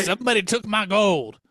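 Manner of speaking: mock Irish leprechaun accent. Co-host mimicking a leprechaun for a laugh, Irish accent. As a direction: try a comic Irish accent. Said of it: "That's my Irish accent".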